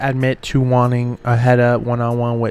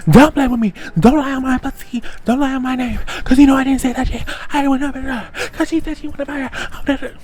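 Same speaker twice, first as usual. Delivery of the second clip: high-pitched, heated mock retort; worked-up high mimicry in an argument; Mariah clapping back; 13 semitones higher, 4 dB louder, faster than usual. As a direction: imitate an indignant woman snapping back, pitched way up. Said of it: "Mariah starts getting back at Sapphire. She's like".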